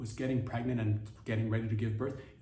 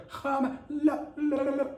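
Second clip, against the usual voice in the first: high, throaty gibberish with rolling la-las; hammed-up throat-clearing nonsense; mock phlegmy babble; 13 semitones higher, 3 dB louder, slower than usual. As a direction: babble loosely in a high put-on voice. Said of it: "if you're flemmed up".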